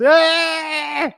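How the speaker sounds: a sudden high yelp imitating the dancer; mock the dancer's cry with a high shout; mock jig yelp. Retelling the contestant's jig, a sudden high mock yelp. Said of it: "and it goes".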